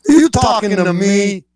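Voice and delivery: high, punchy mock challenge; mock tough-guy retort; confrontational comeback. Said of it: "I'd be like".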